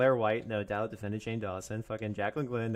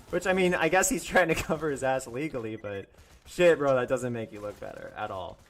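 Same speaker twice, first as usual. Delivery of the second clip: ordinary delivery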